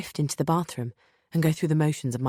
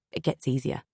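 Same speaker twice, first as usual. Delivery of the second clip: ordinary delivery, nothing marked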